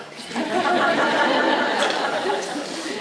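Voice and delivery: loud high burst of laughter; nervous laugh. Real, not acted. Nervous laugh after a joke.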